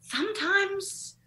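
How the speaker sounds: high, slow stretched sometimes; teasing coy hint; coy drawn out. Real, not acted. Admitting a hookup worked, high and drawn out.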